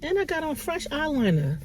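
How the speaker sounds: tearful. Real, not acted. Mother on camera trying not to cry, teary. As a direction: push it out through tears. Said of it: "I said I wasn't gonna cry".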